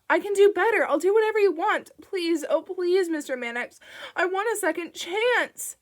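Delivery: frantic screamed plea; screaming plea. A narrator's reading. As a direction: scream your begging after him. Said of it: "Screaming now".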